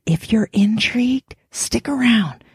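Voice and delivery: hushed whisper. Acted. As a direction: lean in and whisper.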